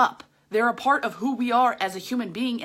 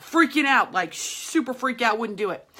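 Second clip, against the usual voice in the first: ordinary talk, nothing marked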